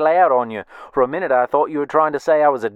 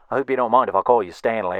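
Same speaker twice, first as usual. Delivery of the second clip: ordinary talk, nothing marked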